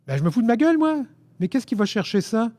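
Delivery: high-pitched, incredulous retort; offended disbelief. A narrator's reading. Narrator answering an accusation of mockery, offended. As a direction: throw it back, high and affronted. Stung reply in the quarrel.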